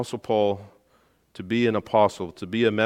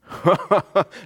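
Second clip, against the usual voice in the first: high amused laugh; 8 semitones higher, 4 dB louder than usual. Real, not acted. Preacher laughs at insanity.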